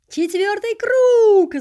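drawn-out high shouting cheer; crowd roar. A narrator's reading. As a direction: bellow it like a stadium crowd. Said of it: "заорали зрители".

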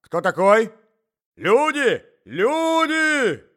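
very high, loud yell; panicked old man's yell; shouting for help. A narrator's reading. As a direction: shout high, stretching the last cry. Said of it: "закричал старик".

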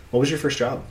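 ordinary talk, nothing marked

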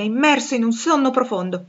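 plain reading voice

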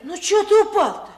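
high, loud teasing question; loud mocking call. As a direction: tease him loudly for falling. Mocking a friend who fell.

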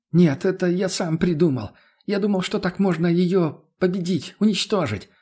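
overwrought captive. A narrator's reading. Voices the captive tsar.

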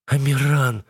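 high, pleading tearful cry; plaintive sob. A narrator's reading. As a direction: whimper the name pitifully. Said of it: "жалобно всхлипывает".

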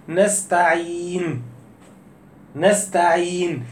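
"نستعين" said slowly, long ī held before the n; stretched madd. Shown as a demonstration. Teacher demonstrates the pause reading, slow stretch. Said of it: "لما تيجي تقول".

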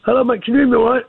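croaky, rough-edged phone voice. Real, not acted. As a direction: speak with a croak in the throat. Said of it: "Tony, with the croaky voice".

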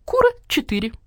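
high clucky hen voice. Acted. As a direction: play the proud hen, pitched high. Hen character voice. (высокий куриный голос)

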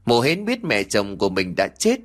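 ordinary delivery, nothing marked

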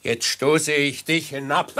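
laut, höher, bedrohlich; stoß die Drohung laut heraus; drohend. Acted. Mörder enthüllt sich beim Stoß. (laute Drohung des Mörders)

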